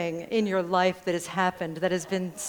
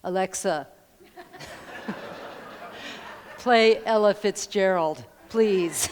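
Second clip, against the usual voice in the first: overly deliberate voice command; careful command to Alexa; slower than usual. Shown as a demonstration. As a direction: address the speaker like it might not understand you. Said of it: "this is the way I do it".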